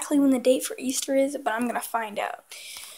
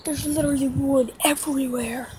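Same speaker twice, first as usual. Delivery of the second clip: ordinary delivery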